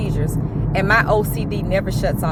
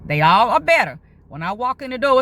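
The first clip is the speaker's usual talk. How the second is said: ordinary talk, nothing marked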